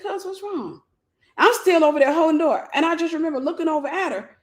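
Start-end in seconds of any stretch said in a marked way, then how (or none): 0.0-0.8 high, soft nonchalant question; calm. Retold or imitated. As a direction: ask it totally unbothered. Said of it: "came walking there so calm".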